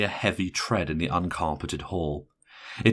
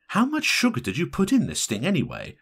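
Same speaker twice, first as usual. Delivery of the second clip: irritated, raised pitch; irritable; 10 semitones higher than usual. A narrator's reading. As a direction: complain irritably with the voice pushed up. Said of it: "she asked irritably".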